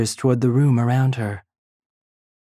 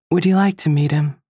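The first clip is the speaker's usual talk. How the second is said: usual speaking voice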